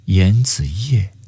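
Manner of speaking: slow, slightly doubtful reading; hesitant. A narrator's reading. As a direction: read 严子夜 slowly, as if unsure of the characters. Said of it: "这一次他有点犹疑".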